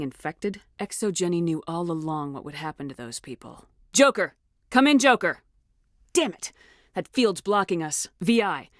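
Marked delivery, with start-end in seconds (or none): 3.9-5.4 loud urgent hail over the radio; urgent radio call. Acted. Hailing Joker over comms. 6.1-6.5 sharp high frustrated curse; annoyed outburst. Acted. Comms fail, frustrated outburst.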